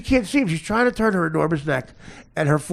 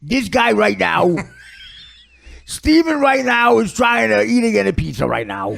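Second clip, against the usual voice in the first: loud, raised-pitch exasperated dad; father imitation; 4 semitones higher, 4 dB louder than usual. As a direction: caricature of a fed-up father, pushed high and loud. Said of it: "The father talks like this".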